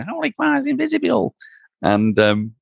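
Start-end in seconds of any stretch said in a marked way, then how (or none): 0.1-1.3 falsetto Lancastrian sketch recital; high-pitched Lancastrian accent. Retold or imitated. Running through the parrot sketch as a drunk student, squeaky Lancastrian impression. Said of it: "in a very high-pitched Lancastrian accent".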